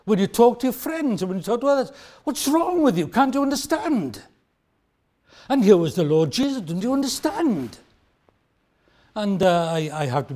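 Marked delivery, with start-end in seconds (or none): 2.2-4.2 higher, exasperated outburst; frustrated. Retold or imitated. Talking to friends, exasperated. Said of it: "you get frustrated like that". 6.6-7.8 frustrated. Retold or imitated. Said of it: "bordered on frustration".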